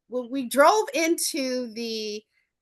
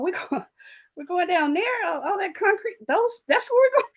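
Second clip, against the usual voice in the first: much higher, laughing, wide-eyed amazement; awed excitement; 8 semitones higher than usual. Retold or imitated. Teen looking down from the plane, in laughing awe. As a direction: gasp it out like a kid seeing a city for the first time.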